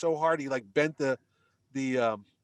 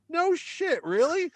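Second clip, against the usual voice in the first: high, amazed no shit; retold surprise; 13 semitones higher, 3 dB louder, slower than usual. Telling the actress he is amazed, high and surprised. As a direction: act floored by the news.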